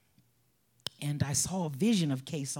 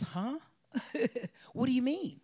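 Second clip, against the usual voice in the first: puzzled reporter; 10 semitones higher than usual. Retold or imitated. Reporter baffled by forgiveness.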